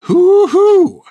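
high, proud, drawn-out hermit cry; cackling old hermit. Acted. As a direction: crow it back, cracked and gleeful. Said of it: "said Hank".

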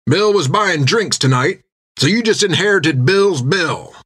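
high, hearty captain's voice; a big captain scolding with a grin; jovial captain. Acted. Jack hollering to Finn down the table, hearty captain.

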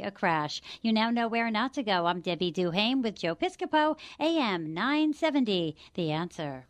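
brisk, even traffic-reporter delivery; polished traffic update with a station sign-off; radio traffic report. Real, not acted. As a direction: run through the road problems in a steady broadcast read.

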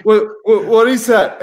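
reliving the shock at the passport desk, panicked; alarmed what. Retold or imitated. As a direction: blurt it out in retold alarm. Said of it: "I go".

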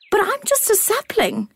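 put-on high, small childlike acorn; a tiny wondering acorn. A narrator's reading.

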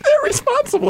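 high squeaky mock voice. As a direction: squeak it out mockingly. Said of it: "They're like".

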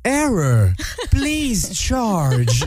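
high, stiff robot vacuum. Retold or imitated. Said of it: "le robot aspirateur Roomba qui se met à jaser".

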